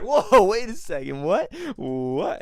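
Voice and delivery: high, loud, slowed shocked protest; mock shocked. Retelling the friends' reaction to the odd host, hammed-up surprise. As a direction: act out being caught off guard. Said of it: "We're just like".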